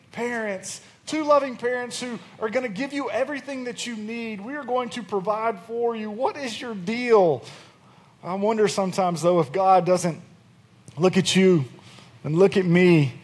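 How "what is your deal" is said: ordinary delivery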